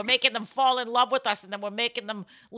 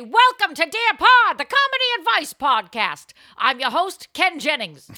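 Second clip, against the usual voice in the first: announcer voice; 5 semitones higher, 5 dB louder than usual. Acted. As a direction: boom it out like a show intro. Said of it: "time to say".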